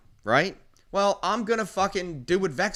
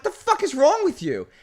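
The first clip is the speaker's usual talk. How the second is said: angry retort; 10 semitones higher, 6 dB louder than usual. Retold or imitated. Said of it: "I'm like".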